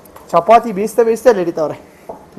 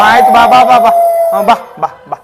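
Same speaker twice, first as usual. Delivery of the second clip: loud soothing; 3 semitones higher, 10 dB louder than usual. Real, not acted. Hushing a crying baby. (ಅಳುವ ಮಗುವನ್ನು ಜೋರಾಗಿ ಕರೆಯುವುದು)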